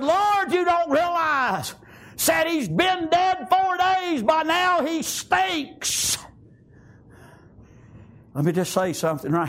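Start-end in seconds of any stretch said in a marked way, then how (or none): 0.0-6.2 high, drawn-out preacher's protest; acted objection in a slow, raised preaching voice; dismayed protest. Voices Martha protesting to Jesus.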